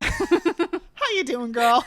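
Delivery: slow, high drawn-out sass; sassy greeting voice. Pretend. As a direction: greet her like a bestie. Playful greeting to absent Rio.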